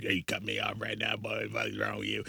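hushed, inhuman road-rage impression; mock a demon confronting a driver, softly. Imitating brother cut off in traffic, soft demon voice. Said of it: "what demon is coming out of you".

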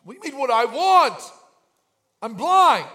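incredulous, what else would I want; indignant retort. Retold or imitated. Blind man answers Jesus indignantly.

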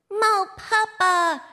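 put-on high, slow baby babble; toddler voice. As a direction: talk like a delighted toddler. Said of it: "she says".

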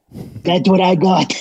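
slow put-on smooth voice; sultry narrator. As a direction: show off the pipes slowly. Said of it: "I got the pipes".

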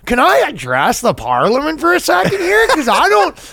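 hammed-up Canadian accent, fed up with parliament; mock Canadian accent. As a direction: play an MP gone full Canuck. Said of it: "I turn into super Canadian".

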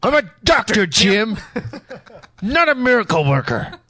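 dramatic doctor voice; intoning like a TV doctor; Star Trek doctor. Pretend.

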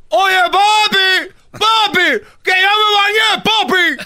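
hammed-up, very high-pitched yell; screaming child. Boy telling his dad he has bathed, comic screaming. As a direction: scream for papi at the top of your voice. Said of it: "le gritaba yo así".

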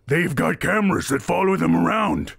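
high, shouted accusation in character; towering tattooed sailor. Acted. Giant sailor warning the tavern about cameras, alarmed shout. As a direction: call it out to the whole crowd. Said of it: "another figure, this towering, like, behemoth Goliath".

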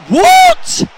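very high, loud shriek of disbelief; outraged high-pitched cry; incredulous shout. Real, not acted. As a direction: yell at a baffling bit of play. Reacting to play on pitch.

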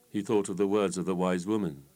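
plain reading voice

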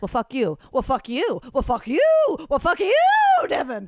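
hammed-up back-and-forth, each round topping the last; sing-song escalating insults. Customer and caller trading curses, building mock sing-song. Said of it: "in like, a song form".

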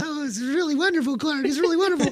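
a hammed-up Marilyn Monroe cooing; imitating Marilyn Monroe. Praising the garden to Clark Gable, hammed-up high Marilyn voice. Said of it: "she's going".

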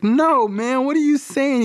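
very high, incredulous mock dad; appalled protest. Dad to Carl about prison talk, appalled. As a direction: object in a rising panic, acted.

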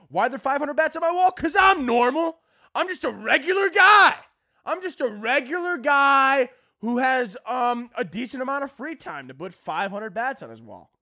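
high, sing-song mock innocence; sarcastic. Comic sarcastically insisting to viewers he is a regular guy, high sing-song. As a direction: overplay being totally normal.

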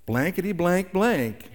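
a hint of a child proudly cursing; little child swearing. Little one after something breaks, lightly mimicked cheerful cursing.

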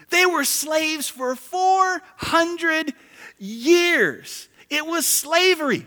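high, loud full-force sermon; fiery preaching. Real, not acted. Pastor thundering at his church family, full-force preaching. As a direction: preach it hard to the congregation.

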